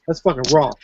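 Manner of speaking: normal talking voice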